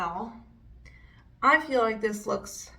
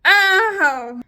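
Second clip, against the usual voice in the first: sharp, loud, high ow; real yelp of pain; 8 semitones higher, 10 dB louder than usual. Real, not acted. Vlogger hurting herself applying makeup, sharp yelp. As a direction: yelp as it stings.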